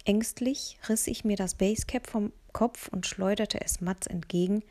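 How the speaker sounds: ordinary delivery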